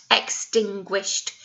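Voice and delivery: slow, careful "Extinguished"; slow model word. Shown as a demonstration. Teacher models "Extinguished" for the class to repeat, slow. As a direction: say "Extinguished" slowly for children to copy. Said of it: "Can you say that new word?".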